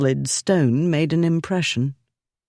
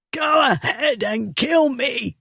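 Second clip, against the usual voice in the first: higher, slow, strangled shout; choked out; 10 semitones higher than usual. Acted. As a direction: force it past a choking grip. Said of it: "the fool choked out".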